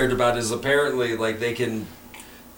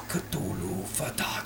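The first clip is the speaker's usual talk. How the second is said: slow, low, quiet robotic intoning; robotic Speak and Spell; 5 semitones lower, 12 dB quieter than usual. Pretend. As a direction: drone it like a toy speech box. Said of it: "is that a Lovecraft speak and spell?".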